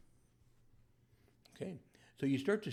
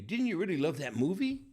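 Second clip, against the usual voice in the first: eager question; 7 semitones higher, 8 dB louder than usual. Shown as a demonstration. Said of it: "if I were to say to you".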